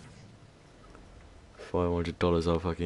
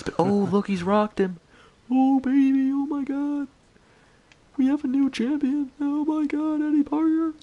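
much higher, louder squealing celebration in a mocking Ricky J voice; Ricky J hype; 3 dB louder than usual. Imitating Ricky J crowning a new champion, high squealing hype. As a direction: shriek it out like a streamer losing his mind. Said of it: "Ricky J impersonation".

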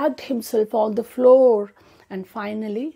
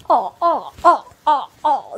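pained cries; 6 semitones higher, 5 dB louder than usual. A narrator's reading. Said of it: "He screamed".

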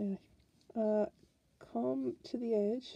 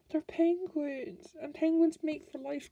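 higher, slower and louder; excited; 6 semitones higher, 3 dB louder than usual. Real, not acted. Telling viewers penguins mate for life, excited. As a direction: burst out with delight.

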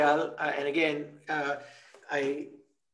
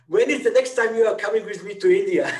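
playful, louder, higher jab; teasing question; 5 semitones higher, 6 dB louder, faster than usual. Real, not acted. Host teasing the speaker about visiting India, playfully. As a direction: tease him with a grin in the voice.

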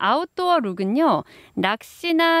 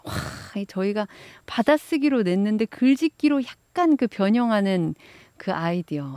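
ordinary talk, nothing marked

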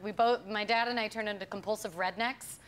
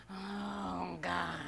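slow, softened mock groan; muttering under breath; 3 semitones lower, 7 dB quieter than usual. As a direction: imitate a director despairing quietly to himself. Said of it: "Under his breath".

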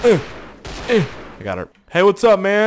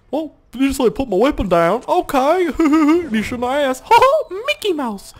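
high, giggling cartoon squeak; silly high laughing voice; squeaky silly voice; 12 semitones higher than usual. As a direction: go way up like a cartoon mouse.

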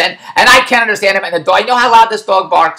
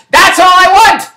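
loud, high, drawn-out plea; exasperated outburst; 11 semitones higher, 5 dB louder, slower than usual. Retold or imitated. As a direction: stretch every word loud and high, at the end of your rope. Retold final outburst at the installer.

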